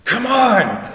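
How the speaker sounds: high, loud burst of frustration; exasperated shout. Retold or imitated. Son crying out to God for an answer, exasperated shout. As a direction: yell it up at the ceiling, half laughing.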